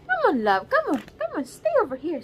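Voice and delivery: high sing-song coaxing; high cooing plea to stay put. Real, not acted. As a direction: coo at the little one to stay close. Coaxing the baby closer.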